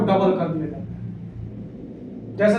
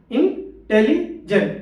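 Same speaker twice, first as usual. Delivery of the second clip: Intelligent slowly in three chunks, in-teli-jent; split in three; much slower than usual. Shown as a demonstration. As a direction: keep "teli" together, pauses either side in intelligent. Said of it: "तीन बार पर".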